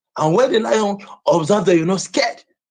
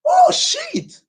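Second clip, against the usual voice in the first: angry outburst. Real, not acted. Furious rant about viewers and lions.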